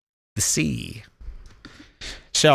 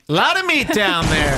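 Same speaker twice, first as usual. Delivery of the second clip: higher-pitched mock reaction; acted exclamation; 5 semitones higher than usual. Red-blooded guy reacts to a bikini picture, higher exclamation. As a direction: lift it up out of your usual voice. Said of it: "And I'll say".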